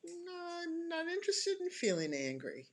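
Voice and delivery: playful refusal. Acted. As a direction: start high on a stretched no, then settle. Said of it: "and going".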